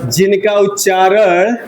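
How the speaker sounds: slow, spaced-out dictation with long pauses. Shown as a demonstration. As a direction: dictate word by word for students to write. Teacher dictates a definition. (धीमे-धीमे रुक-रुक कर लिखवाना)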